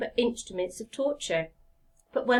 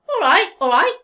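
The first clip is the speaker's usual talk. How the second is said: louder, higher, hands over his ears; flustered woodcarver; 8 semitones higher, 13 dB louder than usual. Acted. Woodcarver giving in to the talking log, flustered and loud. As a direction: give in in a fluster. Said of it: "he said".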